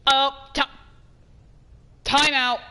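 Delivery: ordinary talk, nothing marked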